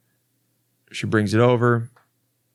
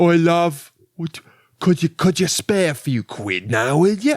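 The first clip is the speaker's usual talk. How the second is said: higher-pitched, broad Cockney patter; 6 semitones higher than usual. Acted. Cockney guy asking a lady for quid, cheeky mock accent. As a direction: cadge money in a put-on Cockney accent. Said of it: "the Cockney guy tried to talk to her".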